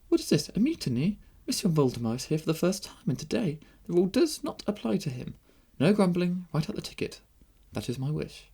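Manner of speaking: high, indignant princess voice; imperious young princess. A narrator's reading. Princess putting down the count's mutiny, imperious. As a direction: overrule them, high and commanding.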